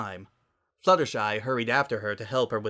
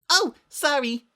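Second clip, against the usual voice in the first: high, bright and breezy; cheerful fake apology; not sorry; 6 dB louder, slower than usual. A narrator's reading. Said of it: "the least sorry person in the world".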